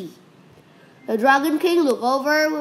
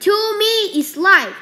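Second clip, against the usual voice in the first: defiant boast; 8 semitones higher, 5 dB louder than usual. Acted. Wukong defies the Dragon King.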